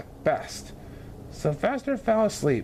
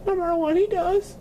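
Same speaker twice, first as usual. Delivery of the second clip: high, tearful vow; a grieving mother promising aloud; mother's voice; 12 semitones higher than usual. A narrator's reading. Mrs Duncan, missing her son, vowing never to scold. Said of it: "said Miss Duncan".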